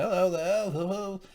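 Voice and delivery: high, lilting hellos; play-acted greeting. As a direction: mimic a character calling hello. Acts out a character's greeting.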